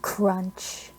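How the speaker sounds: plain reading voice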